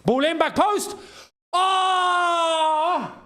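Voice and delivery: sudden high yelp at the chance; excited shout. Real, not acted. Fan watching the match, a sudden high excited shout. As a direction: jump up at the near goal. Near miss at back post.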